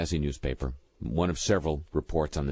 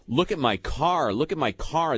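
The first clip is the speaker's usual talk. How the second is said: mock freak-out; 8 semitones higher than usual. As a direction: quote a driver freaking out, raised pitch. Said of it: "She was freaking out".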